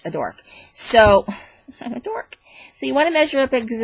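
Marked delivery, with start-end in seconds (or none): 1.5-2.4 laughing whisper. Real, not acted. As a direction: whisper it through a small laugh. Laughs at own mistake.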